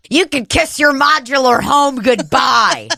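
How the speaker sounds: mock shouted threat. Process server to the woman served, mock shouted threat. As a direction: call it out like a gleeful verdict.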